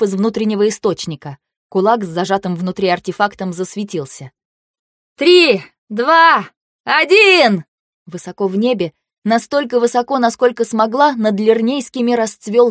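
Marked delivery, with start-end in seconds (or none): 5.2-7.6 loud, high count to launch; shouted countdown. Acted. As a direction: shout the count over the wind. Countdown before casting together.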